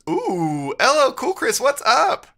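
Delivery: high, loud cheery hello; light up as a friend arrives; excited greeting. Real, not acted. Streamer greets a chatter, bright and loud.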